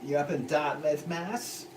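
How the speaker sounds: lightly put-on Massachusetts accent, clearly a failed try; bad Massachusetts accent. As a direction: toss off a quick bad Massachusetts accent as a joke. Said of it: "that's a horrible attempt at a Massachusetts accent".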